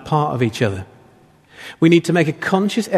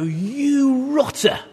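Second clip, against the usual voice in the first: high, slow, laughing scold; scolding hand voice; 8 semitones higher, much slower than usual. Retold or imitated. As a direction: tell off your own hand in a silly voice. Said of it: "and I go".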